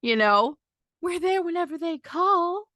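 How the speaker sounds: high mocking voice of self-serving family; mocking fake-caring relatives. Speaker mocking relatives who show up for themselves, high sneering voice. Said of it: "It's for them to say".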